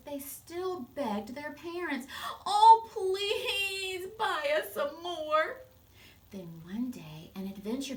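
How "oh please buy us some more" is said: high, loud, drawn-out whine; whiny begging children. Acted. Spoiled children begging their parents for toys, loud whine. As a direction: beg like spoiled kids.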